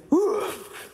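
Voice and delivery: high, loud sucked-in gasp; imitated big gasp of shock; startled revival gasp. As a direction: gasp as if jolted back to life. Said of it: "like that, kind of like, "Whoa".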